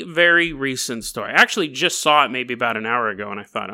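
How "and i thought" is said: ordinary talk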